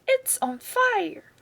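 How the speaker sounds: grandma yelling. A narrator's reading. Grandma on the roof seeing the hen burn, alarmed cry. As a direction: cry out the alarm, high and drawn out. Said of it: "Grandma yelled".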